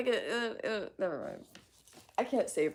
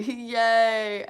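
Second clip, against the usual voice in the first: much louder, raised mock cheer; possibly sarcastic cheer; 4 semitones higher, 10 dB louder than usual. Streamer reading out a viewer's yay, loud mock cheer. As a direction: burst out with an over-big yay. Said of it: "Is that sarcasm?".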